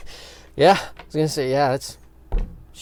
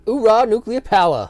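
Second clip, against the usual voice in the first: loud Marine cheer; cheering hoorah; 7 semitones higher, 5 dB louder than usual. Pretend. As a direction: cheer it proudly. Cheer for the sub.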